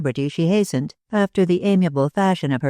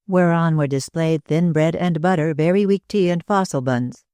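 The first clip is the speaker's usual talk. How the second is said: usual speaking voice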